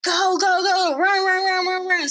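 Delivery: high, urgent mock panic; freaking out. Tormund and them fleeing the dragon, mock panicked urging. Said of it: "they're, like, freaking out".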